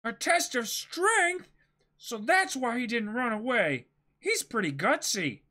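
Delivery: wide-eyed hero, impressed; bold young pirate. Acted. Reads Luffy's lines.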